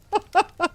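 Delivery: a quick, very high laugh burst; laughing at the jaywalking pun. Real, not acted.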